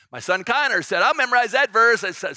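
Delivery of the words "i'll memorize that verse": much higher and louder, gleeful; imitating an excited son, loud and high; eager son. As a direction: shout it like a cheeky kid. Son Connor claiming the verse.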